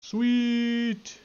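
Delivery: high, drawn-out pleased exclamation; delighted sweet. Real, not acted. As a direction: sing out the win.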